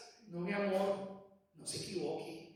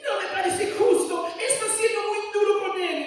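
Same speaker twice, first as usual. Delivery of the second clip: higher, louder protest as the wife; imitating annoyed wife; 11 semitones higher, 8 dB louder than usual. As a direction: mimic the wife's indignant complaint. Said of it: "mi señora se enoja".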